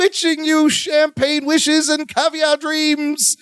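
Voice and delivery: high, slow, grandiose British announcer; Robin Leach. Retold or imitated. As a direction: draw it out like a luxury-show host. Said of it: "a wonderful impersonation as Balky goes".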